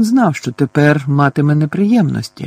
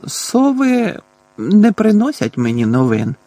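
slow, higher-pitched, reluctant admission; indistinct reply; 7 semitones higher than usual. A narrator's reading. Boy hesitates to admit the truth, speaking indistinctly. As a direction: draw it out hesitantly in a raised pitch. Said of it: "невиразно мовив він".

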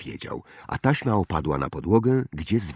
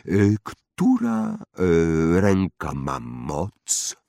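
slow, louder, halting query; wandmaker, hesitant question; 3 dB louder, much slower than usual. Acted. (powolne pytanie wytwórcy różdżek, z wahaniem)

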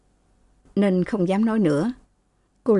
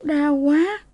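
high, drawn plaintive cry; wincing in pain; 8 semitones higher than usual. A narrator's reading. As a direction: whimper it high as the sore spot is touched. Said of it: "Cô nhăn mặt".

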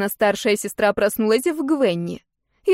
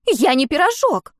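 higher-pitched indignant protest; 7 semitones higher, 4 dB louder than usual. A narrator's reading. Younger sister objecting to being called a pie, indignant. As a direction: protest hotly in a raised pitch. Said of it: "возмутилась Гвенни".

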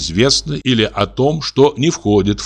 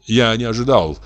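ordinary delivery

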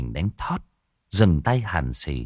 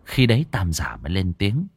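ordinary delivery

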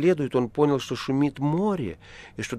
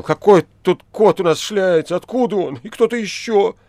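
high, loud panicked shout; frightened shout; 9 semitones higher, 8 dB louder than usual. A narrator's reading. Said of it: "испуганно закричал". (испуганный крик)

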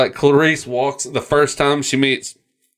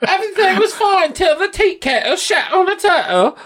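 very high-pitched British whine; shrill comic British falsetto; mock British. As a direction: wail it like a silly British parody. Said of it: "I'd like to think the British".

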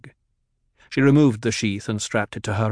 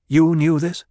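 higher, louder sharp question; demanding; 6 semitones higher, 6 dB louder than usual. A narrator's reading. Jessica confronting the doctor, sharp and demanding. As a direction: demand the truth from him. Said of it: "she demanded".

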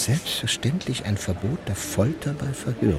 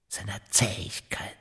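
whispered; 5 dB quieter than usual. Acted. Conspirator musing in secret.

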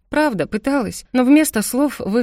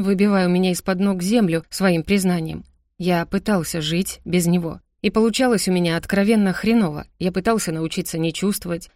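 ordinary delivery, nothing marked

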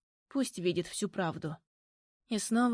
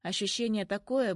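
ordinary delivery

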